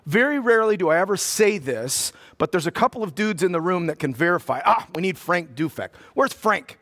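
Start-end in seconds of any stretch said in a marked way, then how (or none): none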